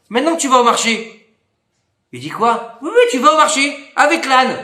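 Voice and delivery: épouse qui insiste. Retold or imitated. L'épouse insiste auprès du mari.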